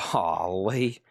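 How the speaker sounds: low-pitched mock disbelief of a new viewer; imagined baffled viewer. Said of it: "Somebody going like".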